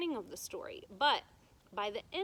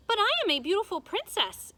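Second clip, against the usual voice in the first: much higher, louder indignant princess; haughty princess; 11 semitones higher, 10 dB louder, faster than usual. A narrator's reading. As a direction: insist on your importance, spoiled.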